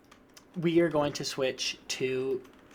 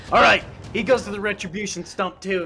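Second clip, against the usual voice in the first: loud, higher pronouncement; orc leader decree; 5 semitones higher, 7 dB louder than usual. Acted. Orc leader sentencing the goblin, loud command. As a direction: call out the sentence loudly. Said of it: "looks at the goblin and goes".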